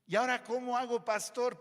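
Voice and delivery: a hint of an exasperated believer begging for help; imitating an upset listener. Voicing a frustrated church member.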